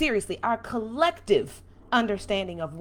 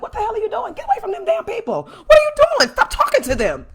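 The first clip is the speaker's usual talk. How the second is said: much higher and louder, exasperated; scolding outburst; 12 semitones higher, 9 dB louder, faster than usual. Retold or imitated. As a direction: scold your own people in disbelief. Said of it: "I'm looking at us like".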